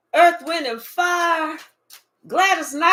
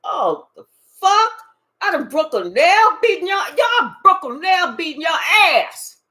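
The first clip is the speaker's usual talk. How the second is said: louder, higher outraged exclamation; a loud, put-out outburst; indignant outburst; 4 semitones higher, 6 dB louder than usual. Retold or imitated. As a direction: act offended about the broken nail. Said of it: "You was like".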